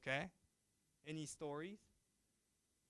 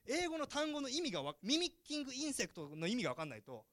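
ordinary talk

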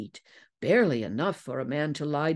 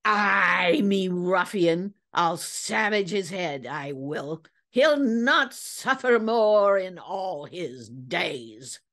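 raised, drawn-out seadog threat; snarled; 3 semitones higher, slower than usual. A narrator's reading. Vengeful whaler threatens his betrayer, snarling. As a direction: snarl it slowly as an old whaler. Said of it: "Ellsworth snarled".

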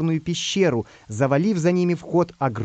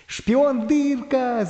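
high, drawn-out cry of recognition; astonished high-pitched shout, stretched out; 9 semitones higher, much slower than usual. Acted. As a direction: call the name out high and slow. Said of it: "закричал Самоделкин".

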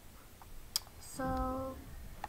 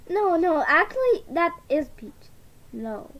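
normal talking voice

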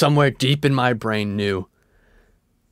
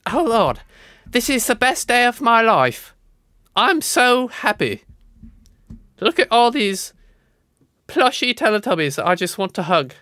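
role-playing the happy kid; 10 semitones higher than usual. Boy in the Teletubby photo gushing to viewers, high childlike delight. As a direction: squeal with delight like a little boy at his party. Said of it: "don't question my role-playing".

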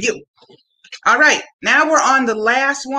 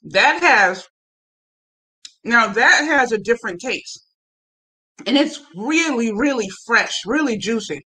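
speaking between bites; chewing while talking; 2 semitones lower than usual. Real, not acted. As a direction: mouth partly full of melon. Seen 1: Bit melon at -4; chews with closed lips while speaking.

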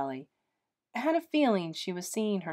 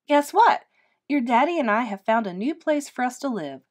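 put-on high, louder, bright-eyed sell to a child; put-on enthusiasm; 4 semitones higher, 6 dB louder than usual. A narrator's reading. Mother cheerfully selling the move to her little girl. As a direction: oversell the news like a kids' show host. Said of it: "infusing her voice with enthusiasm".

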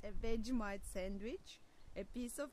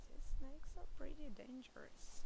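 put-on hushed warning to the children; a soft spooky warning; whispered aside; 3 semitones higher, 4 dB quieter than usual. A narrator's reading. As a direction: lean in and whisper it. Aside to children about snakes.

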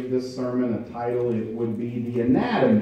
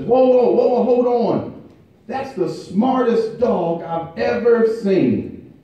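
much higher, louder whoa whoa, then slowing down; astonished butcher; 10 semitones higher, 3 dB louder than usual. Retold or imitated. Butcher stopping the angry owner, high astonished shout. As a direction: throw up your hands and stop him, amazed.

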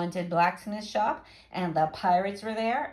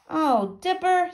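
disappointed; 8 semitones higher, slower than usual. A narrator's reading. Jack let down by refusal.

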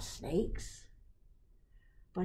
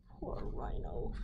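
soft whisper of sympathy; whispered pity; 5 semitones lower, 6 dB quieter than usual. Acted. Reader pitying the rhino to the children, whispered. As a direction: breathe it softly, full of pity.

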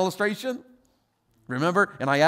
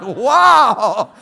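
loud, drawn-out, higher exclamation; amazed wow; 4 semitones higher, 13 dB louder than usual. Acted. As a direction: shout it out in mock astonishment. Acted reaction to offered knife.

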